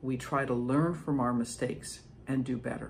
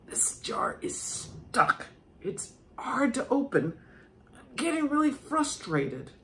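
frustrated parent struggling with a jar, rising higher; demonstrating frustration; 10 semitones higher than usual. Shown as a demonstration. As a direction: voice growing higher and tense. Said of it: "and you name that feeling".

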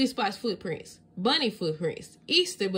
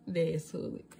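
laughing; 3 semitones lower, 5 dB quieter than usual. Real, not acted. Reader laughs repeating it.

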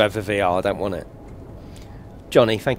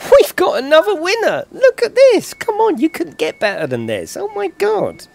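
excited; 7 dB louder than usual. Real, not acted. Excited over a cream egg.

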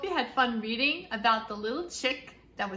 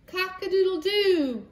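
rooster crow; 7 semitones higher, 4 dB louder than usual. Acted. Rooster crow opening story.

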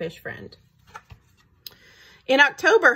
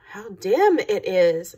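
ordinary delivery, nothing marked

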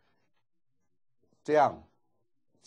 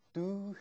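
"do" held long on a raised, curving pitch; circumflex accent; 7 semitones higher, 8 dB quieter than usual. Shown as a demonstration. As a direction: stretch and lift the vowel of "do". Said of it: "叫抑扬音的记号". (抑扬音的do,拉长)

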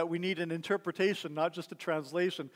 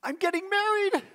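high, loud burst of news; excited blurt; 13 semitones higher, 5 dB louder than usual. Retold or imitated. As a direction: blurt it out like a thrilled friend. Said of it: "your best friend phones you up and blurts".

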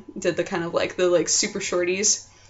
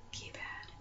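whispering; 25 dB quieter than usual. Real, not acted. Muttering to herself at phone.

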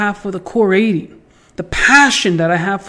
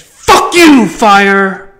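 swearing at the fire; 9 dB louder, much slower than usual. Retold or imitated. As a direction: curse it out at full volume. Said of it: "That's exactly what I said".

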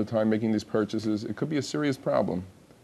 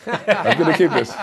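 laughing; 7 semitones higher, 6 dB louder than usual. Real, not acted. Laughing while starting a joke.

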